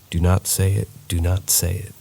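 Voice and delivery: ordinary delivery, nothing marked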